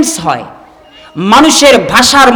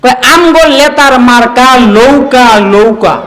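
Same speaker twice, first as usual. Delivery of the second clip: mock Pabna campaign chant; পাবনার আঞ্চলিক টানে; Pabna dialect. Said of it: "ন কে ল উচ্চারণ করে".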